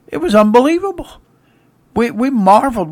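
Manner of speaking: amazed. Acted. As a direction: marvel out loud at the miracle. Disciple marvels at calmed storm.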